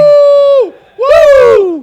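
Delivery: very high, loud whooping; celebrating twenty million views; excited whoop. Real, not acted. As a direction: cheer at the top of your voice. Celebrating their viral video.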